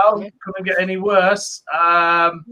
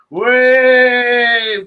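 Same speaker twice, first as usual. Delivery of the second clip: excited cheer; 4 semitones higher, 5 dB louder than usual. Pretend. Mother cheering after joke.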